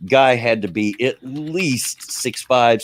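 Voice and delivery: normal talking voice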